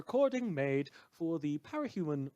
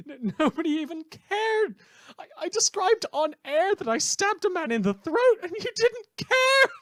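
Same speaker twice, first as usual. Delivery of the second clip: much higher and louder, laughing as it spills out; manic laughing rant; 11 dB louder than usual. Acted. The host rants at his audience, laughing and shrieking ever higher. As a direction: cackle through it, rising to a shriek.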